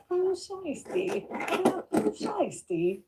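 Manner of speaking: high, slow hammed-up Australian accent; mock Australian accent. Teasing friend with a jokey high, slow Australian accent. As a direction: stretch it out like a cartoon Aussie. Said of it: "[name] can do the [name]".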